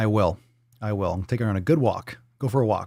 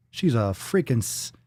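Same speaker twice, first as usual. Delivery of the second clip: ordinary talk, nothing marked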